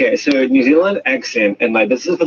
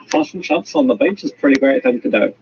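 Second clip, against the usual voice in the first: ultimate broad Kiwi accent; New Zealand accent; 3 semitones higher than usual. Shown as a demonstration. One more time on request, broad New Zealand accent. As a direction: lay on the New Zealand vowels. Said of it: "that's the ultimate New Zealand accent".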